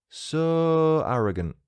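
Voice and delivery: "So" stretched very long, high; drawn-out So. Shown as a demonstration. Host reading out a review title, long high "So". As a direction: hold the vowel of "So" way out. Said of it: "There's three O's in So".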